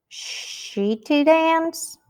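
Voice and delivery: drawn-out, high questioning "she to dance"; incredulous wrong example. Shown as a demonstration. Teacher asking if English really says this, drawn-out questioning. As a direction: stretch "she" long, lift "dance" high. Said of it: "Do you say".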